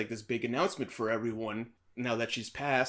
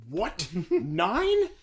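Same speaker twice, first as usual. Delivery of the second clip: pitched-up mock shock, louder on the number; incredulous wife; 7 semitones higher, 5 dB louder than usual. Wife learns of his nine women, incredulous. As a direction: blurt it out in acted disbelief.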